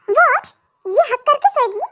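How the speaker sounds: wife startled that the niece wants the middle spot; shocked wife. Acted. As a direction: sound caught off guard.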